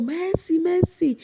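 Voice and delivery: very high called name; mock calling out. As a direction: call her name across the house in imitation. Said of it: "Housemate started shouting on the floor".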